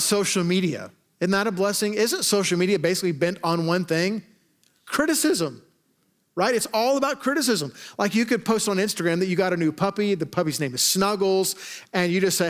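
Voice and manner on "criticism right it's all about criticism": leaps way up on criticism; high mock answer. Pastor mocking social media, pitched high.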